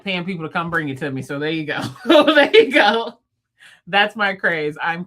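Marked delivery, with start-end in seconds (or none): 1.9-3.2 loud, high, drawn-out laugh through the words; loud laughing exclamation. Real, not acted. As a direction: crack up while saying it slowly. Laughing at her own remark.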